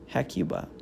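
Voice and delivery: ordinary delivery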